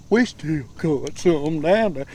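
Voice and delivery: slow, stretched words for a listener who missed it; patient slow repeat; slow drawn-out repeat. Real, not acted. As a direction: drag each word out so they catch it. Fisherman repeats after "Huh?.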